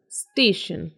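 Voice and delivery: स्टेशन with sh in the second syllable; स्टेशन said as STAY-shun; TIO as SH. Shown as a demonstration. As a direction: model स्टेशन clearly, falling at the end. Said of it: "टी की आवाज़ श".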